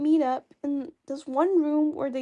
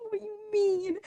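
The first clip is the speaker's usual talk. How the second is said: mock whine; 6 semitones higher than usual. Embarrassed by the examiner's reply.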